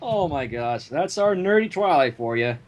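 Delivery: normal talking voice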